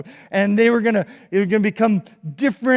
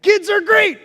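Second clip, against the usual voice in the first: cheerful; 13 semitones higher, 8 dB louder than usual. Real, not acted. Children's talk, cheering kids.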